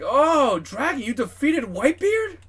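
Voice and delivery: very high, swooping voice of surprise; amazed Kizaru. Acted. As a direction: gush in goofy, loud astonishment. Kizaru amazed at Dragon's victory.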